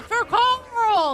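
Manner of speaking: high, loud rallying cry; battle yell. Acted. Player's dwarf yelling in the lobby, loud yell. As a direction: yell it like a drunken dwarf toasting. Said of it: "have your guy yell".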